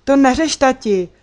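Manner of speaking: loud, high, joyful outburst; blurting happily. A narrator's reading. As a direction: burst out happily. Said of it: "vyhrkla jsem radostně".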